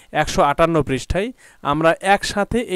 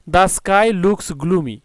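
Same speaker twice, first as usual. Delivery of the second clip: very slow, deliberate English sentence; teacher dictating an example sentence; much slower than usual. Shown as a demonstration. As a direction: read the example word by word. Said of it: "example sentence read".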